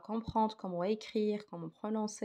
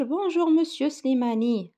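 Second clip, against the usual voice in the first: louder, higher "Bonjour" with a long fall; loud acted greeting; 6 semitones higher, 8 dB louder than usual. Teacher acting the pupil greeting Mr Slimani, loud and high.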